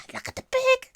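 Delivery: breathless high whisper of delight; very high excited whisper. Real, not acted. Finds the pig sticker.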